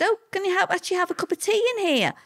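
high, quick, amazed quote of herself; high, quick mock amazement at being offered tea; surprised. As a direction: pitch it way up in wide-eyed surprise. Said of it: "I said".

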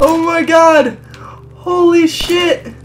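loud, high shouted amazement; hysterical excitement. Real, not acted. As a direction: yell in disbelief, still laughing. Cracking up at game glitch.

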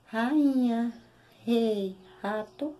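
rainha, rei, rato with a throaty strong r; strong initial r. Shown as a demonstration. Teacher gives example words with strong initial r. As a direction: open each word with a firm throat r. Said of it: "observe que eu digo".

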